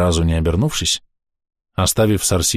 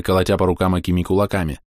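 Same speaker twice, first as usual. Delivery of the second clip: usual speaking voice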